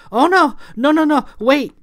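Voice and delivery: very high, loud panic; panicked plea. A narrator's reading. Clara holds her hands out as Thomasina charges, panicked. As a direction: beg frantically in a high voice.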